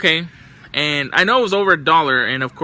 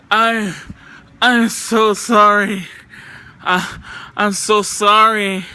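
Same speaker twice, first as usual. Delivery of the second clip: higher, slow, dazed apology, stretched out; imitating a spaced-out woman; 4 semitones higher, 3 dB louder, slower than usual. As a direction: mimic a drugged-out stranger mumbling sorry. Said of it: "She's like".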